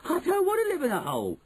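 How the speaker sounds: high-pitched wailing refusal; shouted protest. A narrator's reading. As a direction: protest like a boy in a tantrum. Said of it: "Bruno shouted".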